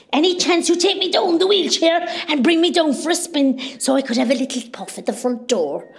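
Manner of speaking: ordinary delivery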